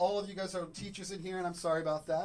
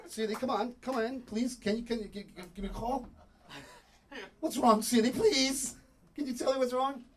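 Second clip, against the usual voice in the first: higher, louder begging; desperate pleading; 7 semitones higher, 7 dB louder than usual. Acted. Man begs a silent Siri to answer, desperate pleading. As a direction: plead urgently with someone ignoring you.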